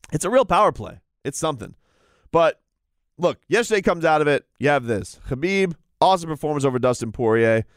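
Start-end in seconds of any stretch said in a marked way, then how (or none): none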